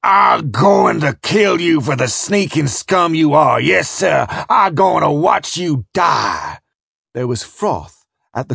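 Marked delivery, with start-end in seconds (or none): 0.0-6.6 slow, raging growled shout; beastly growl. A narrator's reading. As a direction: roar a death threat like a beast. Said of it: "like the growl of a beast".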